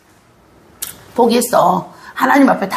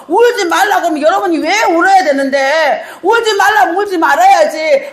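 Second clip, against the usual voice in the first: impassioned pulpit preaching, higher and pressing; preaching at full force; 7 semitones higher than usual. Acted. Pastor urging the congregation in full preaching voice. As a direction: drive the point home to the congregation.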